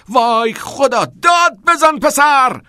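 high, exasperated full shout; angry shout. A narrator's reading. Angry teacher lunges at timid pupil, angry shout. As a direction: yell at him to provoke him. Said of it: "و فریاد زد".

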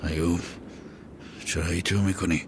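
ordinary delivery

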